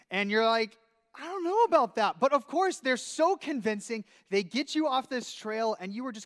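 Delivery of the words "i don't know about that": higher, louder wary protest; doubtful. Retold or imitated. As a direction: push back, unsure. Doubtful reply to friend.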